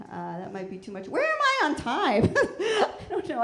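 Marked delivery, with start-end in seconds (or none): 1.1-3.4 high, loud laughing aside to the crowd. Real, not acted. Comic checking time mid-set, laughing loudly. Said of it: "I don't know where I am at on time".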